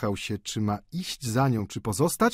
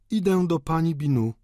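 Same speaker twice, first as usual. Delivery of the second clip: mildly menacing, raised pitch; higher, pointed threat; threatening; 6 semitones higher than usual. A narrator's reading. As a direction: announce it as a threat. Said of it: "powiedziała groźnie".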